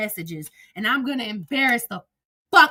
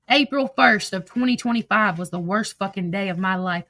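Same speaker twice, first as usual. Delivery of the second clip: ordinary talk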